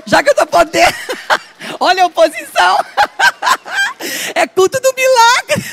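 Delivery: high, loud laughing exclamations. Real, not acted. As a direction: burst out laughing mid-sentence. Laughing at own boldness. (rindo alto)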